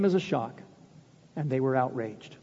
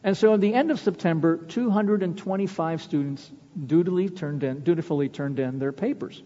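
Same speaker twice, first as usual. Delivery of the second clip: ordinary delivery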